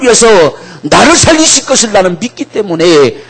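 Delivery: very high, loud impassioned voice; fervent preaching. Acted. Preacher speaks as Jesus about the Father's love, very high and loud. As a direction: proclaim with breaking intensity.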